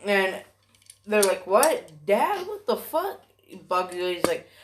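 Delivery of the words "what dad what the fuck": high, rising incredulous squeak; mock-shocked, voice shooting up in disbelief; acted shock. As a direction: pitch climbing with each question. Acts classmates' shocked reaction.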